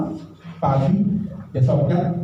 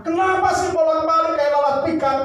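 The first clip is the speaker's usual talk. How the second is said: annoyed scold; 12 semitones higher than usual. Retold or imitated. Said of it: "Saya marah".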